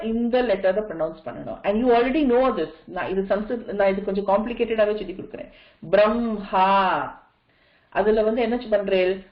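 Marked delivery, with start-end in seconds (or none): 5.9-7.1 பிரம்ஹா as "bram-haa", slowly, m held before the h. Shown as a demonstration. Teacher demonstrates the rule, பிரம்ஹா said "bramm-haa". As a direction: sound the m first, then ha, in பிரம்ஹா. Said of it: "முதல்ல ம pronounce பண்றேன்".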